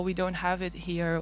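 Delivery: normal talking voice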